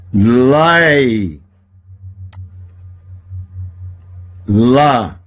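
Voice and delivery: Lai and Lá said apart, low and drawn out; syllable by syllable. Shown as a demonstration. Teacher splits the word for students, syllable by syllable. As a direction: break it into Lai then Lá with a pause. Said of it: "É como se o re não existisse".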